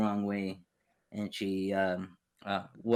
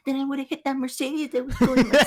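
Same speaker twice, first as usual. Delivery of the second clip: high, louder sarcastic comeback with laughter breaking in; exasperated retort; 6 dB louder than usual. Retold or imitated. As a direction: get incredulous and laugh through it. Retelling his exasperated reply.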